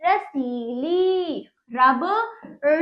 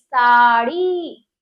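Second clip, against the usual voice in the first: Sari slow, louder and lower, each syllable held; drawn-out vowels; 4 semitones lower, 6 dB louder, much slower than usual. Shown as a demonstration. Teacher reads the word to children, drawn out. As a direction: stretch सा and ड़ी in sari. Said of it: "हम इसको खींचेंगे".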